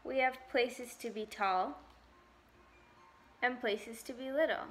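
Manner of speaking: ordinary delivery, nothing marked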